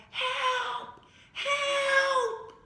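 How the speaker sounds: high, loud cry of "Help! Help!"; screaming for help. Acted. Girl alone in the woods screams for help. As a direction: scream for rescue from down a cliff. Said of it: "She screamed over and over again".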